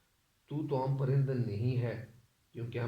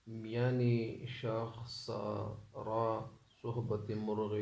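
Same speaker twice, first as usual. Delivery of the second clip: slow, measured verse reading; stately, unhurried couplet recital; poetry recitation; 3 dB quieter, slower than usual. Acted. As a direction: recite the couplet slowly, drawing out each phrase.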